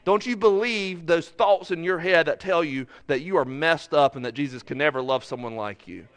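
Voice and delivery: ordinary delivery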